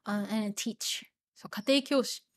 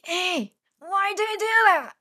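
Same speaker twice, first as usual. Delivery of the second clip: high, loud, exasperated "why do you do that"; irritated complaint; 8 semitones higher, 6 dB louder than usual. Retold or imitated. Schoolboy snapping at his teasing classmate, irritated. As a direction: whine it like a pissed-off boy. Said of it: "when he was a little bit pissed".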